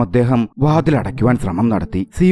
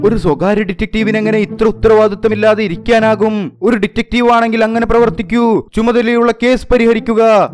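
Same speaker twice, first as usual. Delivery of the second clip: high, loud scolding shout; loud nagging yell at the lazy detective; yelling into a microphone; 12 semitones higher, 4 dB louder than usual. A narrator's reading. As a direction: bellow it at him through the street mic. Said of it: "സിയോൺജി വിളിച്ചു പറഞ്ഞുകൊണ്ടിരുന്നു".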